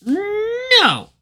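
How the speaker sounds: very high, whiny refusal; whiner. Retold or imitated. Host voices the bird refusing to tell, high whine. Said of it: "he's a fucking whiner".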